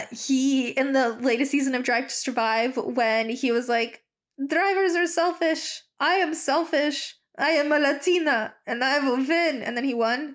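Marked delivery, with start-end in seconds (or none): 4.5-9.6 a higher, lightly put-on Spanish accent, imitating Carlos; mock Spanish accent. Vlogger quoting Carlos from Drive to Survive, in a mock Spanish accent. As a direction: claim being selfish in an overdone Spanish accent. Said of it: "That was not a Spanish accent".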